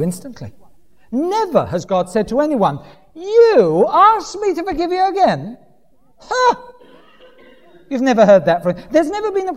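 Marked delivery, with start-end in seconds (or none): 3.1-6.6 high-pitched, hammed-up disbelief; exaggerated affronted question; mock incredulous. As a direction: act scandalised at being asked, ending in a high huff. Acts out God refusing, incredulous.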